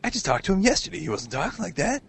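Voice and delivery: high, incredulous confessional voice; mock baffled contestant. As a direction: play a puzzled reality show contestant venting to camera.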